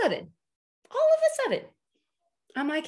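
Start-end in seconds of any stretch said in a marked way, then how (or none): 0.9-1.7 dramatic squeak. Real, not acted. Dramatic repeat of the phrase.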